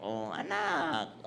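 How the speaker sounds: lightly voiced sweet mother, slow and soft; gentle mother. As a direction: coo "Anak" sweetly like a tender mom. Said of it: "Napaka-gentle".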